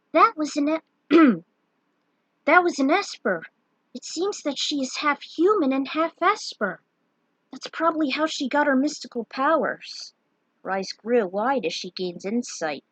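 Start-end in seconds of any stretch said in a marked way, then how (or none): 0.1-10.1 raised, explaining companion; character voice. Acted. Companion tells Lunith about Terra, lightly voiced.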